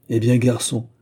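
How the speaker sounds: usual speaking voice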